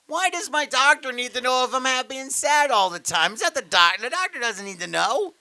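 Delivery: high, whiny comic indignation; rising, exasperated questions; Jerry Seinfeld. Real, not acted. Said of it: "you just sound like Jerry Seinfeld".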